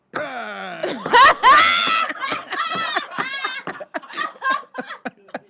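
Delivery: loud high burst of laughter; cracking up hard; howling laugh; big laugh. Real, not acted. Shows his stomp, laughing.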